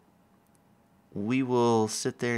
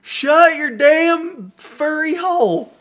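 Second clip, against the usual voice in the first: mock angry yell; 11 dB louder, slower than usual. Yelling at the cat.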